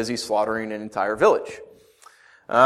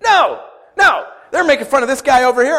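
higher, louder, fast No no; emphatic shout; 11 semitones higher, 7 dB louder than usual. Real, not acted. Preacher shouting down his own question to the church, emphatic. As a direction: shout the answer down, then rush on.

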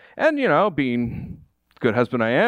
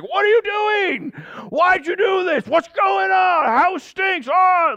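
angry family; slower than usual. Retold or imitated. Family bursting into the smoky room, high angry shouting. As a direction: yell at him like an angry family. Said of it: "They're like".

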